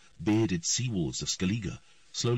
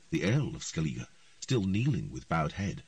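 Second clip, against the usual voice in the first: usual speaking voice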